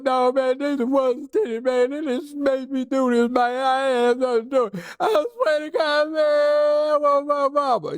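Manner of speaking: high, wailing sobs through the whole confession; mock crying plea, high and tearful; crying for mama. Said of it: "And he's crying".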